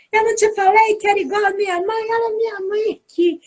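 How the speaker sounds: put-on high, excited child's call from the gate; mock kid yelling across the schoolyard; child shouting. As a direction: yell it across the yard like a kid proving his point. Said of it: "ele gritou lá".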